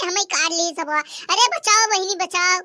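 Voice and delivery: usual speaking voice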